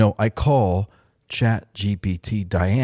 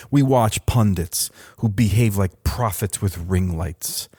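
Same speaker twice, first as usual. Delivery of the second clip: ordinary talk, nothing marked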